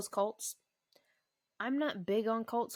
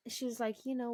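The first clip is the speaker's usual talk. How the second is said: usual speaking voice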